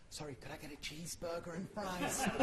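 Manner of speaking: softly repeated order, whispered; mock whisper. Comedian retelling his library joke, hushing the order. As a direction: lean in and hush the order like in a library. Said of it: "I said".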